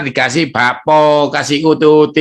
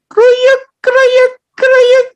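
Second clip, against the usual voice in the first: high, loud mock "kruyuk" rumble; stomach growl imitation; 5 dB louder, much slower than usual. Preacher imitates Pak David's hungry stomach, comic growl. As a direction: squeal out the tummy noise "kruyuk" for laughs. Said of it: "Akhirnya bunyi apa".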